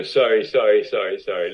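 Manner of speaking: quick string of apologies, loud at first; retold hurried apology. Actor to co-star after spoiling a take, hurried apology. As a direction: blurt it out, then ease off.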